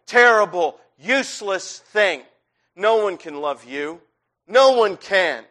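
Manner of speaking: a self-condemning voice hammering worthless, horrible, terrible; harsh inner critic. Acted. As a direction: tear into yourself like a cruel inner voice.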